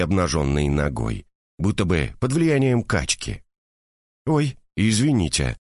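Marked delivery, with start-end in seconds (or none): none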